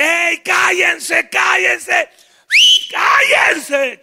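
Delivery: loud high shout, cállense drawn out; shouting for silence. Acted. Josué and Caleb shout the crowd down. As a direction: bellow to hush the crowd. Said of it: "callan a la gente".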